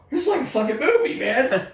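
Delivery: loud, high, fast exclamation; retold amazement. Telling what he thought in the fog, high excited disbelief. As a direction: relive the amazement, voice jumping up.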